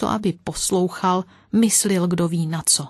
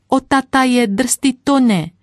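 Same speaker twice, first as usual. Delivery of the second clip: put-on higher, louder shriek of a little girl; louder screeching child; 6 semitones higher, 4 dB louder than usual. Little girl shrieking again at the horses after her cake. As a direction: squeal even harder, like a five-year-old. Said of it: "ona ječela ještě víc".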